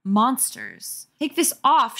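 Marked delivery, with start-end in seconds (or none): 1.2-2.0 higher, louder demand; raised frantic demand; mock scream. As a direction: cry out losing control. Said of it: "she screamed".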